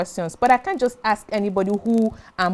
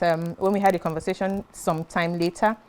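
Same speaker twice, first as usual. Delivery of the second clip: ordinary talk, nothing marked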